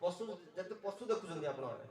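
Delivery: ordinary talk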